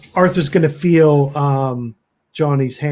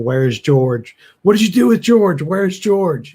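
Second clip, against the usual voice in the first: building into a raised, demanding shout; 6 semitones higher, 5 dB louder than usual. Acted. Arthur bending over the creature, shouting for his missing friend. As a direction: start firm, then shout higher and louder. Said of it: "start shouting".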